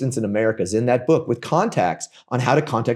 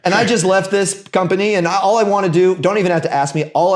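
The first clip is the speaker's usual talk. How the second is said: eager Brad Brown; 6 semitones higher than usual. Pretend.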